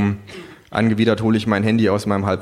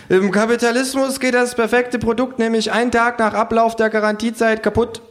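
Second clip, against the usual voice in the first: high kangaroo lecturing; 14 semitones higher than usual. A narrator's reading. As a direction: explain it high as the know-it-all kangaroo.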